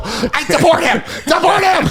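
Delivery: high shrieking yell; hammed-up panicked shout; meltdown shout. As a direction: scream it in a panic. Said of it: "I just melt down".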